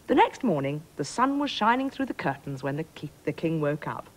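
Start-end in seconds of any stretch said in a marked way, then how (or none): none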